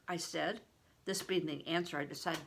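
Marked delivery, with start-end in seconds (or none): none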